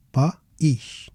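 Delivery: "país" as pa, pause, eesh; two syllables. Shown as a demonstration. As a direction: separate the a and i in "país". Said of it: "they are two syllables".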